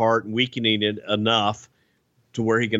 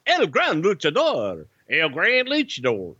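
put-on Alabama accent; 6 semitones higher than usual. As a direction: call the name like a Southern announcer. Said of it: "that's the Alabama version".

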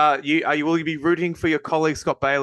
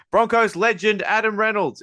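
yelling; 10 semitones higher, 4 dB louder than usual. Shown as a demonstration. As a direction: shout the name loud and high. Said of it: "It's all caps, I have to yell it".

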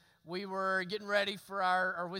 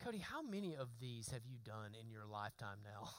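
inner thought; 11 semitones lower, 15 dB quieter than usual. Retold or imitated. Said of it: "and I thought".

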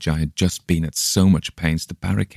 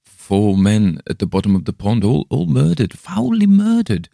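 eerie voice, climbing much higher and louder; unnatural rising wail; 6 semitones higher, 5 dB louder than usual. A narrator's reading. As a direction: build from a trance voice into a wail. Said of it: "in the same unnatural tone".

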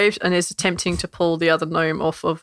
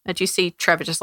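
ordinary talk

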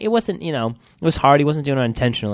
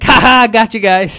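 gotcha laugh; 11 semitones higher, 14 dB louder, slower than usual. Retold or imitated. Teacher reveals prank.

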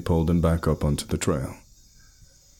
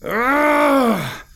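high, loud battle cry yell; battle cry scream; 5 dB louder than usual. A narrator's reading. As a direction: charge in screaming. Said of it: "I screamed a battle cry".

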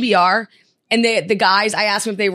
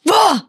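disgusted groan; 9 semitones higher, 5 dB louder than usual. Retold or imitated. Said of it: "And I'm like".

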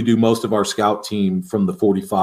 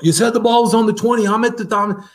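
much higher, fast, whiny put-on player voice; mocking player snapping back; 12 semitones higher than usual. Coach mimics his linebacker snapping back, high whiny mock voice. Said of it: "he snaps at me".

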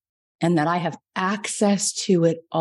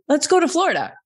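excited suggestion; 10 semitones higher, 3 dB louder, faster than usual. Retold or imitated. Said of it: "And I was like".

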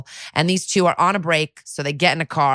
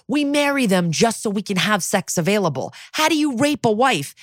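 higher put-on voice for the idiot cops; mocking dickhead cops; 5 semitones higher than usual. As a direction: mimic the clueless cop, voice pitched up. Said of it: "And they go".

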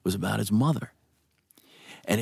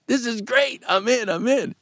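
retold elation; 12 semitones higher than usual. Retells his delight at good news.

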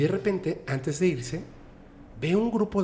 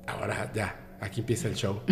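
much softer, conspiratorial; sly quiet aside; 4 semitones lower, 8 dB quieter than usual. Retold or imitated. Man tells compadre the show begins, softly. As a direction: murmur it like a secret before the act. Man whispers before faking possession.

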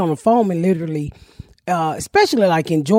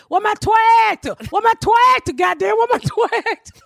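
tractor man; 10 semitones higher than usual. Retold or imitated. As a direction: belt it out way up high like the man hunting for his tractor. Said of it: "this man who walked around and said".

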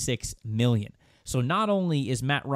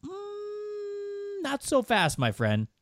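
drawn-out high "mm", then dropping on the catchphrase; teasing objection, starting high and falling away; Lee Corso; much slower than usual. Acted. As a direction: do a sportscaster catchphrase impression. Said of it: "in my best Lee Corso voice".

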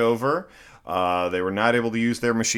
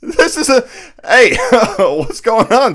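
much higher, louder mock surprise; hammed-up surprise; 9 semitones higher, 8 dB louder than usual.

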